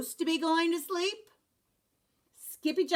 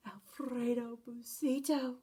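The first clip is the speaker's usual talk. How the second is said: whispered; 8 dB quieter than usual. Acted. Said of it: "whispered the crowd".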